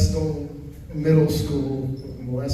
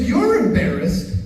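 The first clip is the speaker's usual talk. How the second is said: slow, much higher, disbelieving "You're embarrassed?"; incredulous dad; 12 semitones higher than usual. Retold or imitated. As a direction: stretch it out in mock disbelief.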